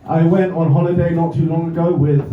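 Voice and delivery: ordinary talk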